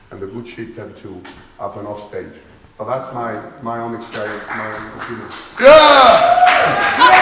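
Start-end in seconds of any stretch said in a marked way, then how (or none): 5.6-7.2 loud rising cheer; excited shout. Real, not acted. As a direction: shout it out with joy. Workshop leader's excited cheer.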